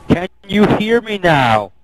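loud and slow mic check; slow down and project. Shown as a demonstration. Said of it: "Is this better?".

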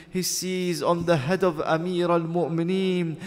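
loud, rising mourning chant of a majlis reciter; loud sung-through lament for the Imam; grieving lament. Acted. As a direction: pour grief into every line, voice climbing.

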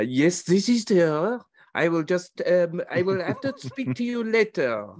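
high-pitched mock French accent; odd French accent. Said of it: "in a really odd French accent".

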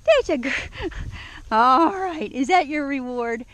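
high-pitched, playful doggy talk; dog-praising coo. Real, not acted. Trainer rewarding Daisy with pats, high playful praise. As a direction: coo excitedly at your dog.

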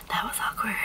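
breathy embarrassed whisper; whispered aside. Real, not acted. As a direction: whisper it like a sheepish confession. Embarrassing aside after wrong car.

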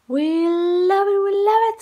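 put-on high, eager children's cheer; excited kids. Children begging the babysitter, high and eager. Kids beg for more rhymes.

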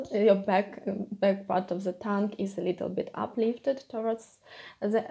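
usual speaking voice

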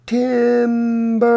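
a huge high shout of Timber; far-off call. A narrator's reading. Paul calling from far across the forest, loud high shout. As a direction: bellow it like a giant across the woods. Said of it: "you just may hear Paul's far-off call".

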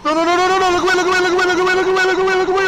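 high, loud panicked screaming; terrified screaming. Real, not acted. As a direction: scream it like you're being chased. Monster chase, shouting.

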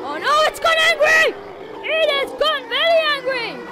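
high, loud alarmed shout; panicked yelling. Real, not acted. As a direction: yell in excited panic. Boss turns aggressive.

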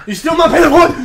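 laughing high, near hysterics; nervous breakdown. Real, not acted. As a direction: crack up mid-sentence. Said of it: "I'm losing it".

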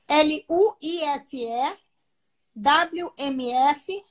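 letters dictated slowly, one by one; spelling her handle slowly for viewers. Real, not acted.

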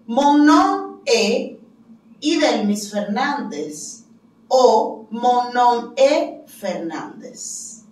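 slow, spaced-out French with pauses; slow language-teacher model. Shown as a demonstration. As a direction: model it slowly for beginners. Models French phrases for learners.

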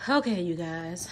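winded after carrying groceries; out of breath, catching her breath. Real, not acted. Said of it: "Sorry if I sound like I'm out of breath".

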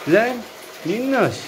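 high sing-song coo to a kitten. Real, not acted. Baby talk to a kitten.

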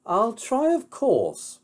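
high, composed lady's voice. Acted. Heroine agrees to help Pig, high and composed.

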